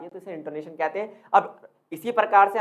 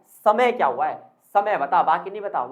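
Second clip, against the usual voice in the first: usual speaking voice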